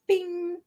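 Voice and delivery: high ringing "bing"; ping it like a little bell; voiced bell chime; imitated bell. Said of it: "I'm gonna ring my bell".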